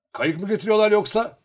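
high, loud shouted question; calling out. A narrator's reading. Luka asking the Cossacks about the boat, calling out. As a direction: shout it across to the others. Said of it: "diye bağırdı".

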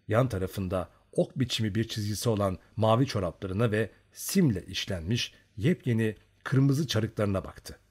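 ordinary delivery

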